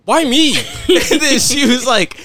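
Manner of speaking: high, loud, laughing disbelief; laughing why me. Retold or imitated. Picked out by a friend's mom, high laughing protest. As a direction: squeal it, caught off guard. Mom picks him to explain.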